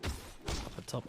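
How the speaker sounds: whispered, slow; whispered aside. Real, not acted. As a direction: whisper it off-mic. Quick aside to co-caster.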